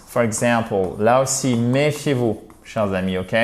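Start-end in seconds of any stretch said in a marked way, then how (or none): none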